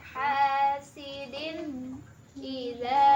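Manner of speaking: very slow, with a long drawn vowel; slow recitation demo. Shown as a demonstration. Teacher models the long vowel for the student, slow and deliberate. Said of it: "Jadi disebutnya matobi'i ya".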